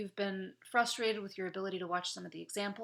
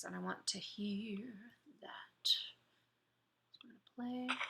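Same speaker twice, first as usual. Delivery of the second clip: whispered; 7 dB quieter, slower than usual. Real, not acted. Teacher starting the clip again.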